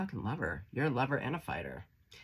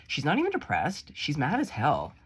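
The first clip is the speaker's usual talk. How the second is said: fired up; 3 semitones higher, 6 dB louder than usual. Real, not acted. Saint's card described.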